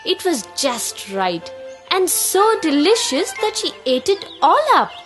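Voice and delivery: plain reading voice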